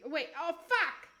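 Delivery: ordinary delivery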